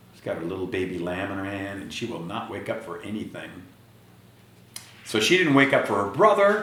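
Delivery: ordinary delivery, nothing marked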